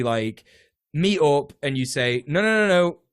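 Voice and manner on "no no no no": raised, louder string of protests; firm objection. Acted. Union meeting the landlord, firm objection. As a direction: cut in to stop them.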